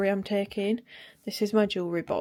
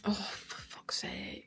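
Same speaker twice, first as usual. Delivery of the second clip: whispered curse under the breath; frustrated whisper; 9 dB quieter, much slower than usual. Real, not acted. Annoyed at a bottle she can't pack, whispering. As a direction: hiss the curse slowly to herself.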